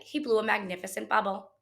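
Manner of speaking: ordinary delivery, nothing marked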